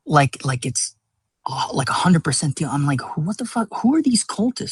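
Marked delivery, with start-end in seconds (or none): 3.2-4.7 mock bafflement. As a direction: replay your own stunned confusion. Acts out own baffled reaction.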